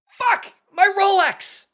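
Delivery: very high, loud scream. Acted. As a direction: scream it out as the horrified lawyer. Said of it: "The lawyer looks down and screams".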